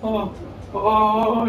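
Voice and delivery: put-on high little-girl voice; delighted seven-year-old. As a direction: gush like a small child over a present. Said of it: "I gave the gumballs to Amber".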